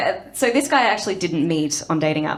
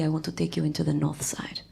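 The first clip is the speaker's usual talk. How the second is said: whispered come-on; 2 semitones lower, 7 dB quieter than usual. Retold or imitated. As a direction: murmur it like a lover. Quoting Stavros.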